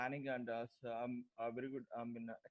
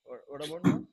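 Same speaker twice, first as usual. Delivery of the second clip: much louder to be heard; speaking up; 4 semitones higher, 15 dB louder than usual. Real, not acted. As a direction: push the voice out over a bad line. Speaking up after complaint.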